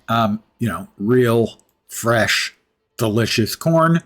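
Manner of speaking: usual speaking voice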